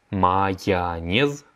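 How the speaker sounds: майонез stretched out slowly, each syllable clear; very slow repeat. Shown as a demonstration. Teacher models the word for students, very slowly. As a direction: drag майонез out slowly for learners. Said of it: "la mayonnaise".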